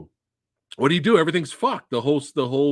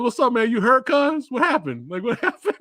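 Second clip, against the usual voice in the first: casual buddy check-in, pitched high; lightly imitated laid-back slang, amused; 9 semitones higher than usual. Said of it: "he's talking to [name] like that".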